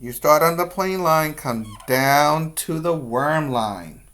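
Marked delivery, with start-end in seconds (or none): none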